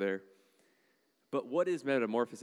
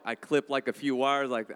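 usual speaking voice

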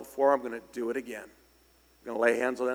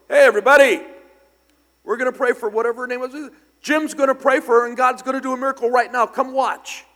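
high, loud preacher call to the room; fast, excited crowd-rousing shout; loud announcing shout; 12 semitones higher, 12 dB louder than usual. Retold or imitated. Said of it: "Charlie goes".

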